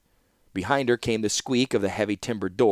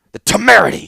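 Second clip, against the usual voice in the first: high, loud enraged roar; furious shout; 11 semitones higher, 10 dB louder, faster than usual. A narrator's reading. Warlord shouting at the rafters in rage. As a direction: bellow it at the rafters. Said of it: "he shouted at the rafters".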